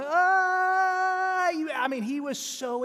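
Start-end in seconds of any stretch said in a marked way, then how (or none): 0.0-1.5 put-on high excited yelp of a thrilled kid; kid's excited squeal. Boy getting all the parade candy, high excited squeal. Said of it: "He was out there going".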